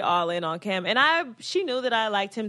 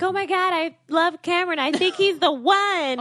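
imitated gushing; 7 semitones higher than usual. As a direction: gush like a smitten girl. Acting out smitten rival contestant.

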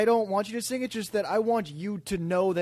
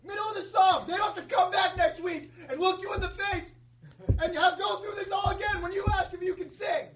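laughing high rant; 9 semitones higher than usual. Acted. Mocking rant at listeners.

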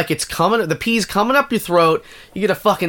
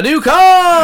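booming prize announcer; 12 semitones higher, 6 dB louder than usual. Pretend. Game show prize reveal bit.

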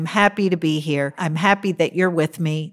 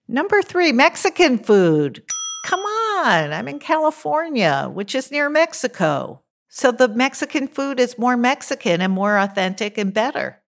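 excited; 6 semitones higher than usual. Real, not acted. Gushing about Mexican food.

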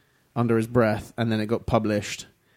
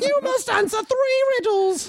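much higher put-on British riddle-master. Setting a riddle for the friends in a high mock British voice. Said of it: "their voice is always so high".

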